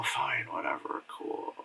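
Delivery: low, slow, trailing mutter; dismissive. Acted. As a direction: give up on it, barely voiced. Mimics indifferent reaction to weak plot.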